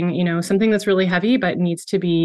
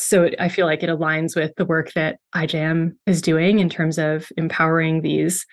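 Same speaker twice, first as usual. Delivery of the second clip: ordinary talk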